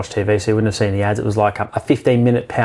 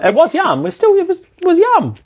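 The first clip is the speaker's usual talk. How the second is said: high insistence. Real, not acted. Defending the food.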